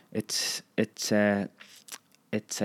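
halting stammer with gaps between tries. A narrator's reading. Trainee searches for an excuse to his director, stammering. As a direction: stall and stumble for an answer. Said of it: "I stammered".

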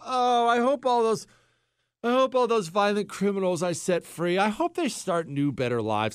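mock naive, high-pitched bedtime musing; sweetly wishful voice, sarcastically put on; Alvin Bragg. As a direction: pretend to sigh hopefully at bedtime. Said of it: "Alvin Bragg doesn't go to sleep tonight".

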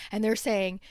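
ordinary talk, nothing marked

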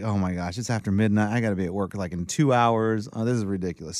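low, soft worried muttering; muttering to self. Retold or imitated. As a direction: grumble it under your breath. Retold panicked inner thought.

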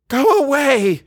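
very high, loud scream; wild shriek. A narrator's reading. A nightmare voice screaming at its tormentor, wild shriek. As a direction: shriek it like a terrified child. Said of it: "shrieking voice".

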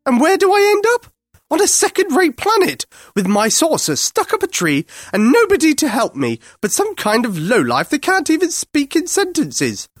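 high, indignant Martian rant; bad-tempered alien. A narrator's reading. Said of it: "clearly in a bad temper".

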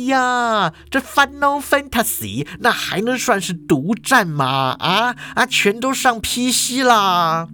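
put-on high, scoffing old principal; scoffing principal dismissing the rival console; scornful principal. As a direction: sneer at the idea, pitched high. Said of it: "王校长就不屑啦".

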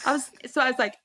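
ordinary talk, nothing marked

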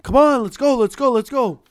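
mock ref hustling the team along; ref urging. Ref hurries team out of timeout.

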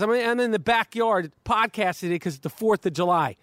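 ordinary talk, nothing marked

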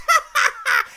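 high gleeful devilish giggle; evil giggle. Acted. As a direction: cackle at his misery. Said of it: "This fucking voice of evil".

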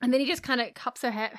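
ordinary delivery

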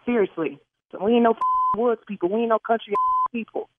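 ordinary talk, nothing marked

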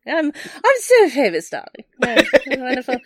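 laughing, grand, posh-pretend star voice; mock diva. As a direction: play the vain celebrity, laughing. Joking about being famous.

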